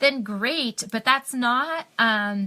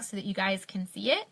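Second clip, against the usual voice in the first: ordinary talk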